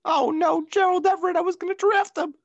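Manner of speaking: squeaky high-pitched mock distress; high mock wail.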